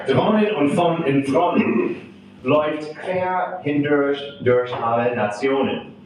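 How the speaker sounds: put-on German verse recited with an American accent; poem reading. Translator reads Tucholsky's German satire to an audience, measured poem reading. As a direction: recite in measured phrases, pausing between lines. Resumes the poem after a stumble.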